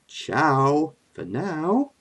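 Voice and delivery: very slow, suave farewell; Loki. Acted. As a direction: draw out a smug goodbye. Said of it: "Mister Loki".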